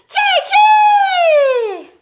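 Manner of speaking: very high piglet squeal, loud; high shout. Acted. Piglets all shouting at the sleeping dog, squealing. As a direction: shriek it like tiny piglets. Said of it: "todos los cerditos también gritaron".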